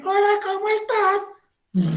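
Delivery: high, slow silly voice mocking Superman. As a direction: make the hero sound ridiculous. Superman abre la boca. (voz tonta y aguda, burlándose del galán)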